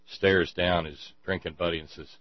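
ordinary talk, nothing marked

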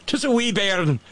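high, playful Scottish lilt; Scottish accent. Acted. Shepherd's wife admiring the baby, mock Scottish accent. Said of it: "maybe she's Scottish".

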